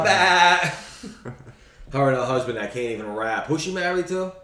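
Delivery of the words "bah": loud, high mock baa; sheep bleat. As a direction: bleat out a joking sheep call. Imitates sheep in quote.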